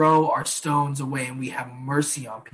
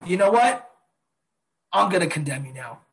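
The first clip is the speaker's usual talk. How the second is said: mock judge; 2 semitones higher than usual. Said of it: "slam the gavel as the judge and say".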